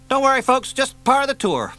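high, loud breezy call to tourists; loud joking reassurance. Acted. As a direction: shout a cheeky joke to the gaping tourists. Edwards jokes to tourists, loud.

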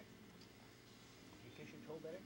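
kissing it better. Real, not acted. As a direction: coax it out slowly and softly. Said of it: "first take, then repeated".

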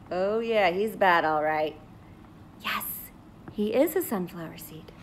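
ordinary delivery

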